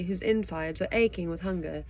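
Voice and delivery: ordinary delivery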